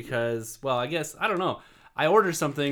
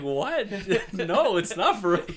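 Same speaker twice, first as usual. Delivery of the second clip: laughing; 6 semitones higher than usual. Real, not acted. Laughing while retelling kid story.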